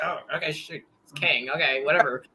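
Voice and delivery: quiet defeated okay; deflated giving in. Retold or imitated. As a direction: shrug it off. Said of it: "I'm like".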